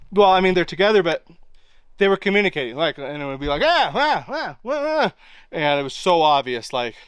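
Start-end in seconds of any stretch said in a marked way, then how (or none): 3.6-5.1 imitated animal calls. Young animals calling each other.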